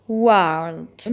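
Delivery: "world" with a strong American R; a low, loud American "world". Shown as a demonstration. Teacher repeating American "world" for learners, American pronunciation. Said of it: "في الأمريكان أكسنت هنقولها".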